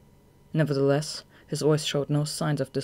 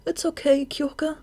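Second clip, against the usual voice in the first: put-on much higher, gentle young man; reassuring; 12 semitones higher than usual. Atsushi comforts Kyoka.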